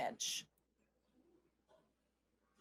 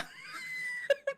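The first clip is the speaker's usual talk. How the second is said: burst of laughter. Real, not acted.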